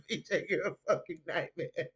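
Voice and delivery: words broken up by laughter; laughing. Real, not acted. Streamer rereading a viewer's comment, laughing. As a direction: read it out while cracking up.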